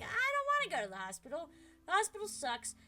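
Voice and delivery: whiny protest. Retold or imitated. Refusing hospital, whiny protest.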